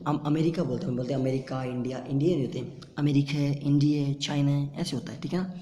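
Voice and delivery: normal talking voice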